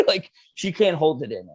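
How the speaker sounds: ordinary delivery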